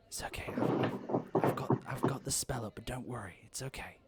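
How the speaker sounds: whispery, hushed aside; secretive reassurance. Acted. Miriam to her friend at the fair, hushed reassurance.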